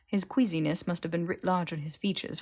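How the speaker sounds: ordinary delivery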